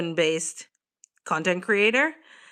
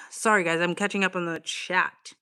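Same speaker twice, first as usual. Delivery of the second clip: normal talking voice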